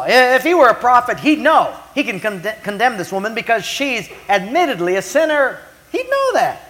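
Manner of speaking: high, loud mock outrage climbing to a squeak; hammed-up righteous protest; indignant accuser. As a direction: play a scandalized accuser, pitch soaring at the end. Acted Simon condemning the woman.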